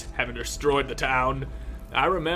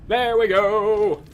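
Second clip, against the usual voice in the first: loud, high and slow cheer; triumphant; 8 semitones higher, 7 dB louder, slower than usual. Real, not acted. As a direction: crow with victory. Triumph after lockpick.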